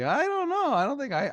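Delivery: high, puzzled wife voice; lightly caricatured clueless reply; imitating wife. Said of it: "She's like".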